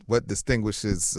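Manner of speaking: normal talking voice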